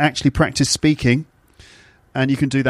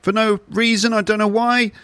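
rising pitch; 7 semitones higher than usual. Shown as a demonstration. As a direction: pitch climbing steadily higher with each line. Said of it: "My intonation tone is rising for no reason".

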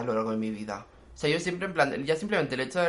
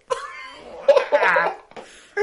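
real burst of laughter; 4 semitones higher, 7 dB louder than usual. Real, not acted. Laughing at the absurd moment.

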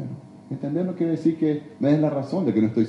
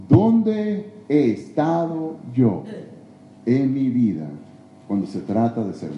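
drawn out very slowly, each word weighed; preacher asking the congregation slowly to look inward; slow searching question; 4 semitones lower, slower than usual. Acted. Preacher to congregation, a very slow reflective question. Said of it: "preacher repeats the question".

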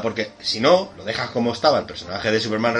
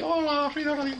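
high, soft mock little girl; high girly Dorothy voice; 14 semitones higher, 10 dB quieter than usual. Joking about Kansas, squeaky Dorothy voice. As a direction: chirp like a cute Dorothy.

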